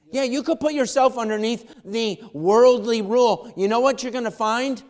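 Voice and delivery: loud, high-pitched pulpit preaching; rising revival preacher; preaching at full force. Acted. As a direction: preach it out to the whole room. Preacher surges again.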